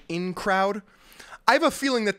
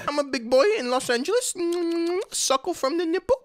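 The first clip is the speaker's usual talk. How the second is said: high-pitched babyish mockery; 12 semitones higher than usual. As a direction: play a grown man as a suckling baby. Mocks man drinking from baby bottle.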